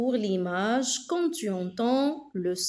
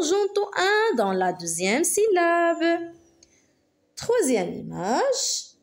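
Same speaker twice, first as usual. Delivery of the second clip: normal talking voice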